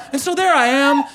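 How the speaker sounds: ordinary talk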